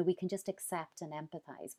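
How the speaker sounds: usual speaking voice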